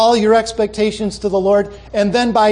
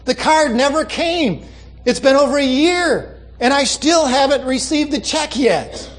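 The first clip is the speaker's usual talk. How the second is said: higher-pitched, aggrieved letter-writer; pitched-up, put-upon complaining; emphatic complaint; 4 semitones higher than usual. Retold or imitated. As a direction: imitate an indignant bride reading out her grievance. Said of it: "I put the emphasis there".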